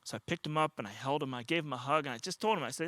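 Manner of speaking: normal talking voice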